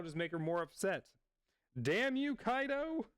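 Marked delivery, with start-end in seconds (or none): 1.8-3.1 mock outrage. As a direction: shake a fist at Kaido in play.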